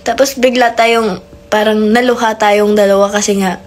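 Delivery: usual speaking voice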